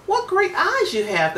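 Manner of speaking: put-on high, excited little girl cry; amazed child exclaiming. A narrator's reading. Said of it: "the girl cried out".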